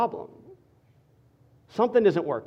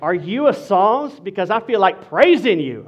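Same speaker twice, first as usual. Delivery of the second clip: sassy; 4 dB louder than usual. Shown as a demonstration. Pastor demonstrating a last pickup line to singles, sassy. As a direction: stretch the question out, then land it loud and sassy. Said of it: "You gotta say it with some sass".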